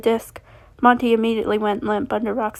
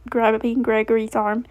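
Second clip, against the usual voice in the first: ordinary delivery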